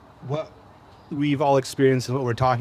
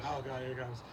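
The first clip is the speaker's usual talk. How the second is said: muttered under the breath, much softer; a dreading aside, barely voiced; quiet groan to self; 2 semitones lower, 16 dB quieter than usual. Storyteller stargazing alone, dreading friend's approach, muttered softly. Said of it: "And I'm like".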